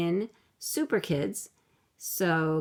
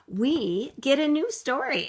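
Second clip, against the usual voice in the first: excited; 7 semitones higher than usual. Real, not acted. Teacher excites kids about story.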